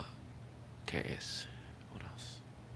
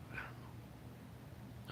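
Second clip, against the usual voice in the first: whispered; 18 dB quieter, much slower than usual. Real, not acted. Muttered aside, then repeated.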